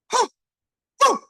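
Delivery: two separate high, short woos; excited whoops. Real, not acted. Speaker fired up over endless gifts, excited whoops. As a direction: burst out with sharp high whoops.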